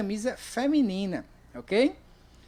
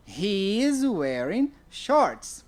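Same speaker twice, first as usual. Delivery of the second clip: "He is wearing shorts." said slowly and clearly; slow, clear example answer; slow model answer; much slower than usual. Shown as a demonstration. Teacher to class, slowly modelling the answer. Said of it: "Aí você me responde".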